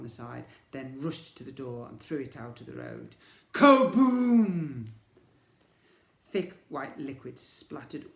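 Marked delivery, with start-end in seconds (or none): 3.5-4.9 very loud kaboom; explosion. A narrator's reading. Narrator voicing the exploding duck. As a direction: blow it up loud.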